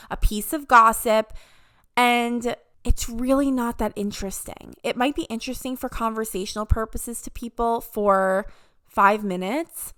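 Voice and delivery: usual speaking voice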